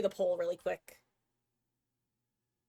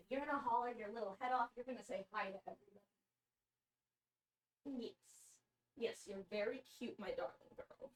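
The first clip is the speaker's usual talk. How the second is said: soft cooing; 13 dB quieter than usual. Real, not acted. Streamer coos to her cat on her lap, soft hushed baby talk. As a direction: coo quietly to a pet near the mic.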